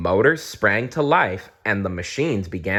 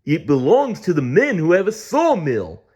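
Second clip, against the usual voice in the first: Splodge voice; 8 semitones higher, 5 dB louder than usual. A narrator's reading. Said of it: "said Splodge".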